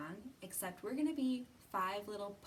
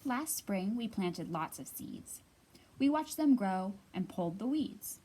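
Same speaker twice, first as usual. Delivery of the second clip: louder, lively storybook read-aloud; reading to children; 8 dB louder than usual. Acted. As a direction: read it out big and bright for a circle of kids. Reads picture book aloud.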